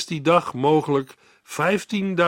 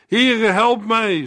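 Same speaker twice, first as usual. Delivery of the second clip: higher, louder, slow desperate cry; Peter's cry for help; 9 semitones higher, 4 dB louder, slower than usual. Acted. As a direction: cry out while sinking into the water. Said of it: "schreeuwde hij".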